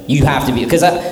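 normal talking voice

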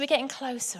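ordinary delivery

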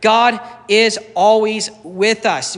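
slow, weighty declaration; slow emphatic. Real, not acted. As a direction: proclaim it slowly. Repeated key sermon point.

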